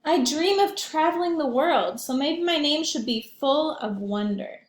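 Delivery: lively picture book read aloud; gently animated storytime voice; reading to children. A narrator's reading.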